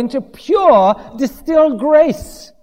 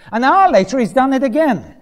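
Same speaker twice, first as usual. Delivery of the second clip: ordinary talk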